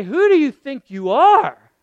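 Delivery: loud, high indignant challenge; mock indignant rebuke. Preacher voicing a scolding Jesus, loud. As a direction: snap it like an offended master.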